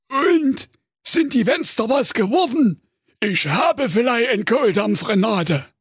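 greedy cartoon giant; ogre voice. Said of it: "fragte ungeduldig". (komische Menschenfresser-Stimme)

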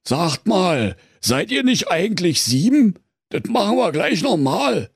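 high, mildly stern bear; lightly strict bear. A narrator's reading. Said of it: "sprach der Bär streng".

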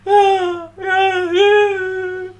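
high, loud sobbing yeses. Retold or imitated. Crying boy answers Tilly on the phone, sobbing. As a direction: gulp out each ja through tears.

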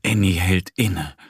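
usual speaking voice

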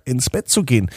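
ordinary delivery, nothing marked

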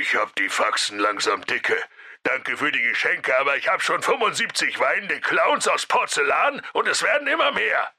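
fed-up complaint, climbing in pitch; exasperated fairy-tale caller, rising to high pitch; Big Bad Wolf. A narrator's reading. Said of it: "Als nächstes rief der böse Wolf an".

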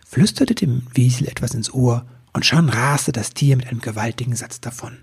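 usual speaking voice

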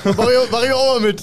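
high, loud and eager; excited. Real, not acted. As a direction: jump in all fired up. Eager joining in. (begeistert laut)

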